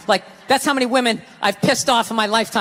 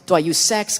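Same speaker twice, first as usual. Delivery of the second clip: mock scheming girlfriend; 7 semitones lower than usual. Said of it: "manipulation mode".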